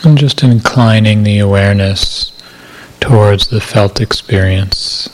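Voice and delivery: calm, measured meditation voice with long silences; guided meditation. Acted. Meditation teacher settling listeners into body awareness, calm guided meditation. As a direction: guide listeners gently into body awareness, unhurried, evenly paced.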